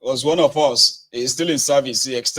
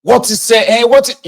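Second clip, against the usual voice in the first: higher and louder, sputtering disbelief; angry outburst; 7 semitones higher, 9 dB louder than usual. Shown as a demonstration. Showing listeners how to react in outrage. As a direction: splutter like you can't believe it. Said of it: "You know, like that".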